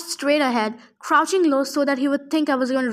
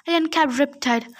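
plain reading voice

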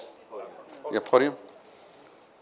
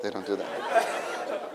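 soft, slow, laughing; 6 semitones lower, 11 dB quieter, slower than usual. Shown as a demonstration.

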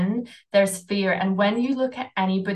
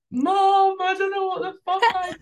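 high, fast, whiny wail to mom; phoning mom from the airport, mock tearful high wail; mock crying to mom; 11 semitones higher than usual. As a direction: replay a panicked call home, voice cracking up high. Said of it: "I was crying in that airport".

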